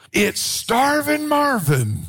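high, stretched-out mock holler; slow, high-pitched playful nickname call; hailing by nickname. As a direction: call it out like friends greeting a local legend. Friends hailing him by nickname.

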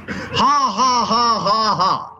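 put-on laugh starting high and falling; made-up laugh. Shown as a demonstration. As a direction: make yourself laugh on purpose. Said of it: "I made myself laugh".

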